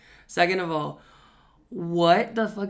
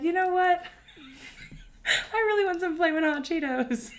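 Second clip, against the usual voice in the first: higher, giggling through the craving; laughing; 11 semitones higher than usual. Retold or imitated. Stoned him deciding on Cheetos, higher and giggling. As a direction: laugh while voicing the stoned idea.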